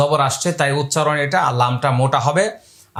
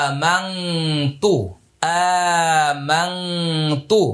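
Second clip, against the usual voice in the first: very slow, drawn-out demonstration; word by word; much slower than usual. Shown as a demonstration. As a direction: isolate the word and hold each long sound. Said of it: "আমি প্রত্যেকটা আলাদা আলাদা করে বলছি". (আলাদা করে ধীরে টেনে উচ্চারণ)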